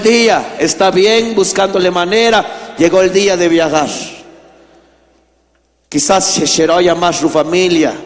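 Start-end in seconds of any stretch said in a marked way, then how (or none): none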